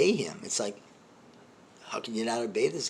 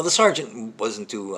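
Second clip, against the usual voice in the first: ordinary talk, nothing marked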